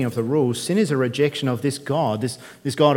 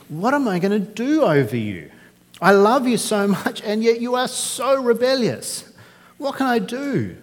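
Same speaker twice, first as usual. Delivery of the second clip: higher, anguished pleading, slowing on rebellious; agonized plea; 10 semitones higher than usual. Acted. Preacher voicing God torn over rebellious Israel, anguished. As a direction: cry it out like a heartbroken father.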